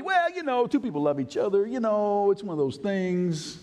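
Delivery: high, whiny excuse-maker voice; mock excuse. Preacher mocking a churchgoer's excuse, high whiny voice.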